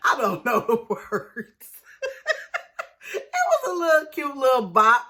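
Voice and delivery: words breaking up in laughter; laughing. Real, not acted. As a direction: talk through real giggles. Laughing at her own singing.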